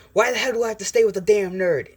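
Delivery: high, loud indignant outburst; throwing a tantrum. Acted. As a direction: yell it like a bratty tantrum. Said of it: "just throwing a tantrum".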